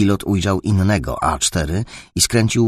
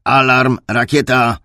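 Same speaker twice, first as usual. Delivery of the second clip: louder, higher missile warning; raised-voice radio alert; urgent alarm call; 5 semitones higher, 6 dB louder than usual. Acted. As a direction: call out a sudden warning to the squadron. Pilot warns of incoming missile.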